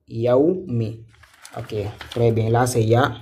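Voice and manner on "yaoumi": ordinary talk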